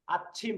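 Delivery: soft "আচ্ছিম", rising at the end; demonstrated pronunciation. Shown as a demonstration. Teacher repeats the example for students, careful soft "আচ্ছিম". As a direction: hold the "চ্ছ" in "আচ্ছিম", then release air. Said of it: "এরকম হবে তখন".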